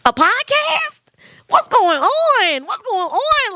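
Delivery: high squeal of disbelief; drawn-out, high-pitched amazement; mind-blown disbelief. Retold or imitated. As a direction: act out being utterly floored. Retelling her disbelief at recognition.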